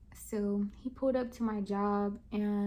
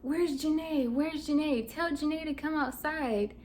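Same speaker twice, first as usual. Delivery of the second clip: retold yell, pitched up and insistent; mock screaming; 5 semitones higher than usual. Retelling him demanding Janae at her job, mock screaming. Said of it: "he starts screaming".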